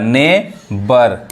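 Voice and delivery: नेबर very slowly as "nay... bar"; low, slow नेबर with no "gh" sound; silent GH, syllable by syllable. Shown as a demonstration. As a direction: break नेबर into two drawn-out syllables. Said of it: "जी एच नहीं पढ़ा रहा है".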